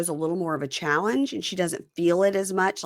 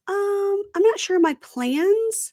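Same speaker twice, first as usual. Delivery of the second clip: coy hesitant reply; 9 semitones higher than usual. Retold or imitated. She hesitantly agrees to second date.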